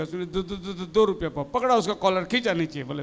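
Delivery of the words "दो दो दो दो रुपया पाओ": lower, soft stutter on दो; stammering seller. Acted. The seller stammering his price back, lower and soft. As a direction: repeat दो haltingly. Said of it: "दोनों तोतला है".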